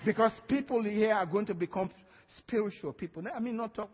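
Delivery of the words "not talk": usual speaking voice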